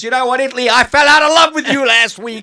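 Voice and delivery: loud, high mock heartbroken cry to Italy; mock heartbreak shouted at Italy; mock lament. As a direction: play the jilted lover, overblown. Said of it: "addressing Italy, mock heartbreak".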